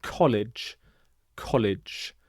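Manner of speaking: college said clearly as KOL-ij, ending in j; contrast model word. Shown as a demonstration. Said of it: "goes like this".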